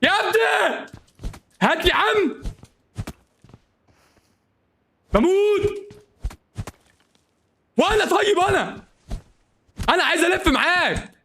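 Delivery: very high, strained wail; high-pitched pleading for help; desperate cries to teammate. Real, not acted. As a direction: cry out way up high. Yelling to teammate while dying.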